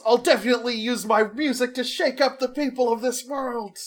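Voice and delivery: high, fired-up heroic cry; yelling. Retold or imitated. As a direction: shout it like a hero vowing. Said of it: "he yells".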